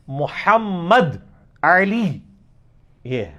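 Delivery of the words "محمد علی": "محمد علی" very slowly, every syllable drawn out with Arabic consonants; pronúncia árabe enfática. Shown as a demonstration. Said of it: "میرا نام محمد علی نہیں ہے".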